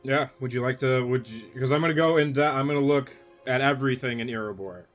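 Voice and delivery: normal talking voice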